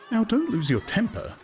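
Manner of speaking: high, light soothing woman's voice; calm him down brightly; a woman placates her gloomy date; Lenina soothing. A narrator's reading. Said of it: "she said".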